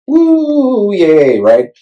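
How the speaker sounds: a high whoop of mock delight; playful woo-hoo; mock cheer. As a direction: cheer it like a kid with a new toy. Cheering about the new mic.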